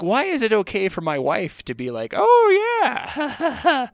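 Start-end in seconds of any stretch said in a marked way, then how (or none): 2.2-3.9 much higher and louder, drawn out and lecherous; a creepy gleeful oh yeah like a pervert; leering oh yeah. Retold or imitated. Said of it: "like a pervert hanging around".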